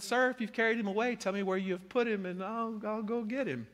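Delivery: a hint of desperate pleading; pleading Mary. A narrator's reading. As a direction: beg the gardener for the body. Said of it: "she said".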